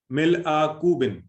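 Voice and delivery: slow teaching recitation. Shown as a demonstration. Teacher carefully drilling the phrase for students, slow and emphatic. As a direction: spell the Arabic out for the class, slowly. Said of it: "کپ کو چونکہ عربی میں پا کا".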